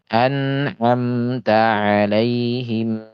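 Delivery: "An'amta" slow and smooth; flowing correct reading. Shown as a demonstration. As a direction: let "An'amta" flow. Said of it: "Harusnya dialirkan".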